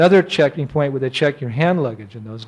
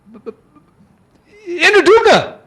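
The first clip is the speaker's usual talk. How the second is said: acting out a tongue-tied, nervous disciple; high-pitched panicky blurt after a faltering start; nervous stammer; 6 dB louder, much slower than usual. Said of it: "I was so nervous".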